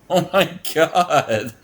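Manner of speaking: high, loud laugh, slowed by laughter; can't stop laughing at her. Real, not acted. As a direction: crack up in disbelief. Laughs hard at the exchange.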